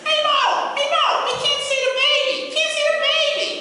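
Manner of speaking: very high, loud, whiny Stooge voice; Curly impression. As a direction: whine that you can't see. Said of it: "Curly starts complaining".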